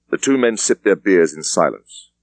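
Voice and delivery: plain reading voice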